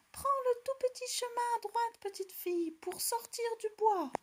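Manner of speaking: a small bear helpfully calling directions; little bear calling. A narrator's reading. Little bear calls directions to the girl, high tiny voice. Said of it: "de sa petite voix ... crier aussi".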